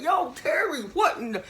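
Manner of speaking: high, stunned mock guard outburst; shocked guard. Guard finding Terry stuck in the toilet, shocked high call. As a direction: play the baffled guard finding him stuck.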